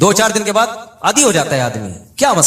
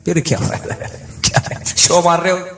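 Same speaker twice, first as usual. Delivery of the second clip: casual put-down. Retold or imitated. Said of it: "जिस style से इसने बोला".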